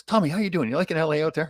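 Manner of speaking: put-on high, teasing old tough guy; mock friendly taunt. Old enforcer greets traded rival, mock friendly. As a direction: sweetly needle the young player.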